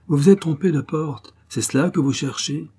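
high, loud, defiant. A narrator's reading. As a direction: challenge the soldiers boldly. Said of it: "dit-elle à voix forte". (à voix forte, avec défi)

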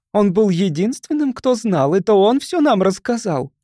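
high, insistent accusation; objecting. A narrator's reading. Mrs. Sharp accusing the boy before neighbors, objecting. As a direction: argue the case against him. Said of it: "возразила миссис Шарп".

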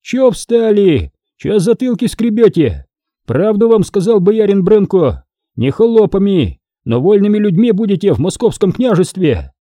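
high-pitched, gruffly rousing call; rally the peasants with a restrained bark; mild bark. A narrator's reading. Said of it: "рявкнул".

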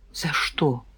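whispered, low and very soft; barely audible whispered question; hushed question. A narrator's reading. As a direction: ask it under your breath. Shocked question about the sentence.

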